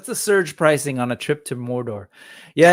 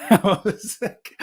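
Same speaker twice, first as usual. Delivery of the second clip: higher, breaking into real laughter; laughing; 5 semitones higher, slower than usual. Real, not acted. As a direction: say it through helpless laughter. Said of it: "I'm just laughing".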